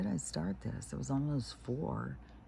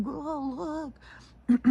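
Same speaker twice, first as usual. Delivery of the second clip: high put-on dog voice; voicing the dogs; 3 dB louder than usual. Owner voicing her dogs' answer in a silly high voice. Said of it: "And they're like".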